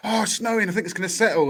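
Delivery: put-on high-pitched, gleeful child's squeal; imitating excited kids. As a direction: pitch it up like a thrilled kid. Voicing excited kids in the snow.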